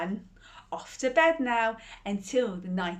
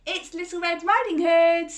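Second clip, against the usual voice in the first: high, loud call through the door; a sing-song higher call of hello; 8 semitones higher, 8 dB louder than usual. Acted. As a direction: call out brightly to grandma. Girl calling through door.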